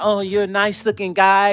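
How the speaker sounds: lightly voiced flirty woman; mocking Shayna. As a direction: coo it like a bad pickup line. Imitates Shayna's compliment.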